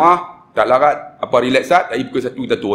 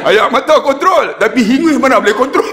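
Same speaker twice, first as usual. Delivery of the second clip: high-pitched, hammed-up struggle for control; comic protest; 10 semitones higher, 4 dB louder than usual. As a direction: squeal it as a mock-tearful joke. Joking about men fighting tears and snot. (suara tinggi berjenaka menahan tangis)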